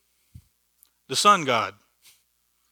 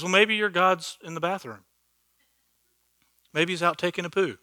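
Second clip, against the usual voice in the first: a hint of higher, mocking taunt; mocking Elijah; 4 semitones higher than usual. Elijah taunting the prophets of Baal, lightly mocking. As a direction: tease them like Elijah would, lightly. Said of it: "Elijah starts making fun of them".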